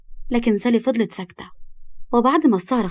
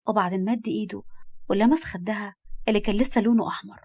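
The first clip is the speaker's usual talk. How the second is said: ordinary delivery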